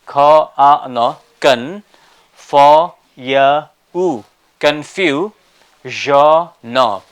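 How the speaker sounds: confusion broken into loud separate syllables. Shown as a demonstration. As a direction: sound out "ka, a, no, kun, fo, ya, u" one beat each. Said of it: "It has to be".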